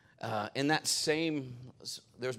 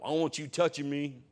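imitating the lineman; 3 semitones higher, 5 dB louder than usual. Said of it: "I'm just trying to talk like him".

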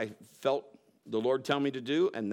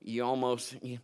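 ordinary talk, nothing marked

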